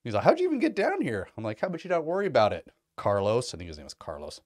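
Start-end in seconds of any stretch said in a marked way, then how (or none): 0.2-1.3 mock guard. Guard catches him sneaking in. 1.6-3.5 cocky retort. Retold or imitated. Retort to guard who caught him.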